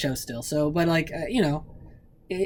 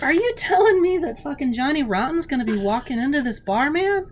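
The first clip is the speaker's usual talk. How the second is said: worked-up disbelief; 11 semitones higher, 6 dB louder than usual. Retold or imitated. Incredulous retold outburst in bar.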